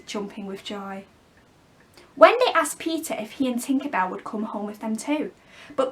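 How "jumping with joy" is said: ordinary delivery, nothing marked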